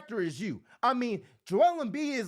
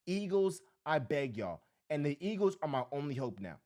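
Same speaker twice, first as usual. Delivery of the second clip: ordinary talk, nothing marked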